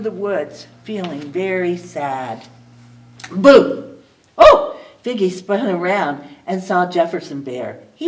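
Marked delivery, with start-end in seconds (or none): 3.3-4.0 playful boo. Acted. Bear startles Figgy. 4.4-4.8 high, loud startled oh; startled gasp. Acted. Figgy startled by the bear's boo, high startled cry.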